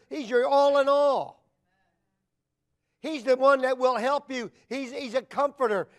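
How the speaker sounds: loud, emphatic preacher's declaration; building preaching. Real, not acted. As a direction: proclaim it with mounting conviction. Preaches at full force.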